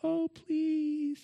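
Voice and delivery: high pleading whine; whiny voice. Retold or imitated. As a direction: whine it like a begging child. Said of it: "get this whiny voice".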